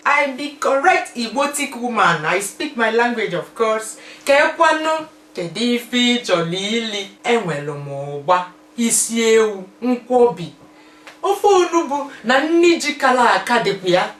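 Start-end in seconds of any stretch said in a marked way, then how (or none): 0.1-2.3 loud, high Nigerian Pidgin boast. Pretend. Showing off her Igbo pride in loud Nigerian Pidgin. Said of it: "Do you speak your language?". 4.2-14.1 loud, high lively Igbo; spoken Igbo. Shown as a demonstration. Showing viewers she speaks Igbo, loud and lively. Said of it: "Do you speak your language?".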